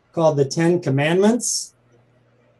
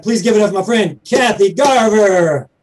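announcer hype; 4 semitones higher, 5 dB louder than usual. Acted. Host introduces guest to audience.

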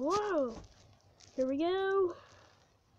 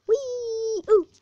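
excited whee; 7 semitones higher, 6 dB louder than usual. Real, not acted. Coaster drop.

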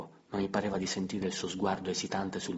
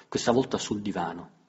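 ordinary delivery

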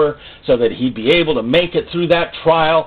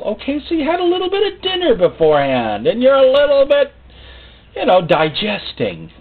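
mock excuse-making; 14 semitones higher, 3 dB louder than usual. Mocking disciples' excuses.

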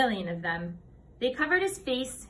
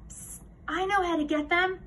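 whispering; 7 semitones higher than usual. A narrator's reading. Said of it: "Sharon whispered to him".

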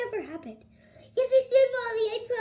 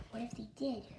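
hushed worry; 7 semitones lower, 12 dB quieter, faster than usual. Pretend. Scared girl wonders about robots.

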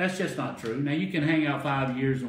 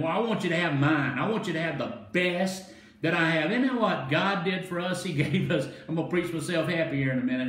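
raised, excited sermon delivery; fired-up preaching; 5 semitones higher, 3 dB louder than usual. Real, not acted. Preacher telling congregation God gave the best, fired-up preaching. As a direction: preach yourself happy, driving each phrase. Preacher worked up over the parable.

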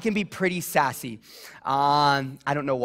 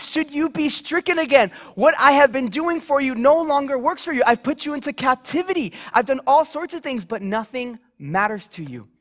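higher, louder earnest plea as God; impassioned voice of God, raised; God pleading with Israel; 7 semitones higher, 4 dB louder than usual. Acted. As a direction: preach it as God's grieved appeal to his people. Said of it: "God is going to Israel, and he says".

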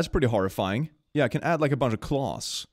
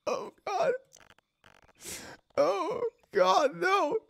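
high, squeaky voice cracking with laughter; losing it completely; tearful giggling disbelief; helpless laugh-crying; much slower than usual. Real, not acted. Breaking down laughing at creature.

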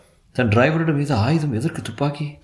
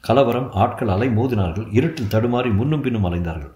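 ordinary delivery, nothing marked

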